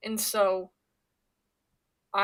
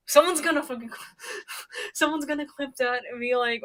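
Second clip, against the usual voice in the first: loud, higher, breaking into a real laugh; 5 semitones higher, 6 dB louder than usual. Real, not acted. Streamer predicting that someone will clip this, laughing. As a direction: crack up partway and restart through it. Cracks up predicting being clipped.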